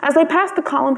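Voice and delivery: usual speaking voice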